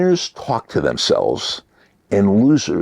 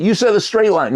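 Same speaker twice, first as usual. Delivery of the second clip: higher accusing inner voice; protesting little voice; 11 semitones higher than usual. Acted. Little voice in the listener's mind disputing the puzzle, protesting. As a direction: object like a mind catching a trick. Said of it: "the little voice in your mind saying".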